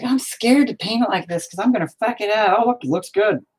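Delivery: high-pitched fretting voice; put-on high voice of a scared painter; mock scared. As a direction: act out your own worry, way up high. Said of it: "I'm all like".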